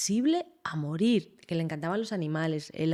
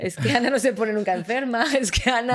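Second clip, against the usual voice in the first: laughing while mimicking the family's old refrain; teasing imitation broken by laughter; laughing mock quote; 4 semitones higher, 5 dB louder than usual. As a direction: quote it through a laugh, a bit louder. Mocking family's repeated remark, laughing.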